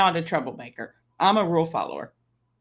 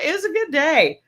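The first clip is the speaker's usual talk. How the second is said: happy high; 9 semitones higher, 4 dB louder than usual. Real, not acted. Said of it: "Like, it's a good day".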